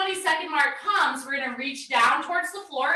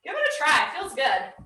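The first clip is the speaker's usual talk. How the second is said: ordinary delivery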